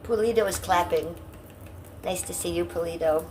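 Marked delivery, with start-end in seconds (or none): none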